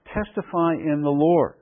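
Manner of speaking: slow, solemn emphasis, LORD drawn out; very emphatic. Shown as a demonstration. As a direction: TESTIFY IN THE LORD, each part slow and deliberate. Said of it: "very emphatic".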